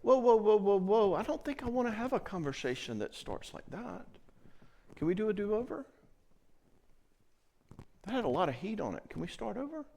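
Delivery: a hint of a steady limit-setter; mock calm boundary voice; firm but gentle pushback. Shown as a demonstration. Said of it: "I want you to say".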